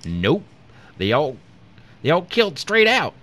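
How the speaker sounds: country farmer, rising high and loud; unbothered farmer. A narrator's reading. Farmer telling agent about the crash, unbothered. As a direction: climb in pitch like a matter-of-fact rustic. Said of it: "the farmer sighed".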